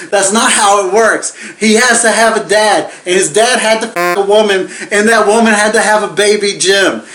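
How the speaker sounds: worked-up rant. Real, not acted. Rant about missing dad.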